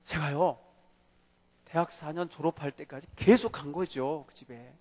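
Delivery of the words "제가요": ordinary delivery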